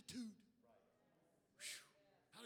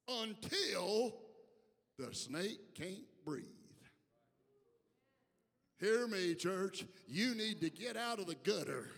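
preaching at full force; 8 dB louder, slower than usual. Real, not acted. Preacher warning church about temptation, full-force preaching. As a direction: drive each phrase home, raised and forceful.

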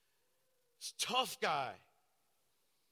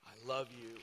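softer, tender I love you; tender reassurance; 8 semitones lower, 9 dB quieter than usual. Retold or imitated. Pastor to the weeping young man in jail, soft and tender. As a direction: soothe him gently through the glass. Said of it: "and I said".

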